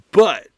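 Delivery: the word but punched hard and loud; emphasis on but. Shown as a demonstration. Preacher stressing but to his listeners, loud and punched. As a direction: hit but with full force, loud. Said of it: "I'm gonna put an emphasis on that word".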